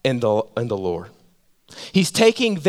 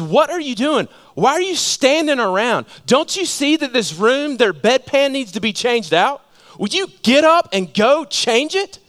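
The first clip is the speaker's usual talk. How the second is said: much higher, scolding nurse voice; bossy head nurse; 9 semitones higher than usual. Retold or imitated. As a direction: order the new guy around, impatient. Head nurse scolding new surgeon.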